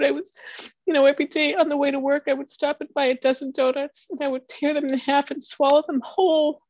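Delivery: high, strained voice through tears; tearful voice. Real, not acted. Speaker recounting her daughter's self-harm, still crying. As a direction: keep talking while crying.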